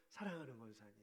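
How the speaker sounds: soft, low, loving address; tender and soft. Shown as a demonstration. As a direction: say it gently like a caress. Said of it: "마음이 포근해지는지". (부드럽고 낮게 다정히 부르는 말)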